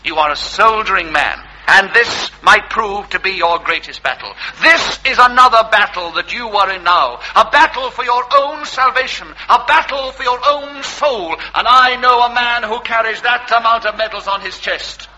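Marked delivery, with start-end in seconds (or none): 1.7-13.5 sermon voice. Acted. Vicar preaches to tramp.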